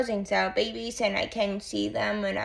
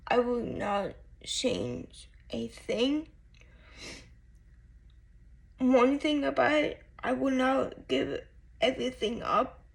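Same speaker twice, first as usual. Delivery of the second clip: crying; 2 semitones higher than usual. Real, not acted. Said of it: "But I don't know why I'm crying".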